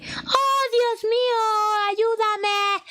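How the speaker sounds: high, crying plea for help; anguished cry. Retold or imitated. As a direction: wail to God in torment. Demon monk cries out at psalm.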